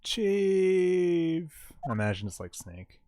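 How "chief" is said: high, loud call. Acted. Maya calling out to the fallen chief, loud and high. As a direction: call her name out.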